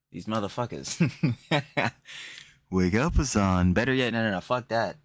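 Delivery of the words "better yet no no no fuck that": normal talking voice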